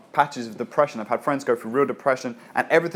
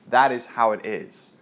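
ordinary talk, nothing marked

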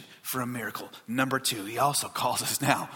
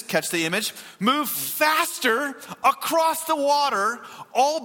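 fervent preaching; 9 semitones higher, 4 dB louder than usual. Real, not acted. Pastor picturing Jesus crossing the storm, fervent preaching. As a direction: stretch the key words and keep driving upward.

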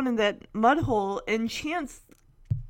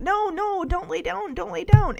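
urgent pleading; 6 semitones higher, 6 dB louder than usual. Acted. Begging Shadow not to lie down.

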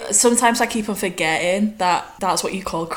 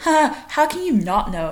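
high, loud incredulous question; mock disbelief; 8 semitones higher, 5 dB louder than usual. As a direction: imitate someone astonished at such ignorance. Imitating people baffled by others' ignorance.

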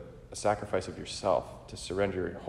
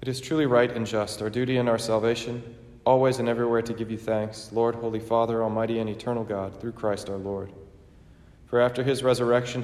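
raised, ringing liturgical chant-speech; solemn preface; 4 semitones higher, 5 dB louder than usual. Acted. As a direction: proclaim it to the whole church. Sung-speech preface, raised and louder.

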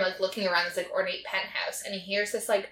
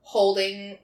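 ordinary talk, nothing marked

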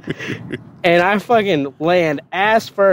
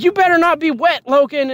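drill sergeant voice; 12 semitones higher than usual. Retold or imitated. Drill sergeant threatening, high.

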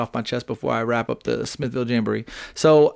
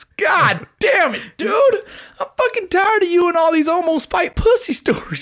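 much higher, loud fed-up rant; hammed-up angry father voice; exasperated dad; 3 dB louder than usual. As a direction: play the dad losing patience, way up high. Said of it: "and he goes".

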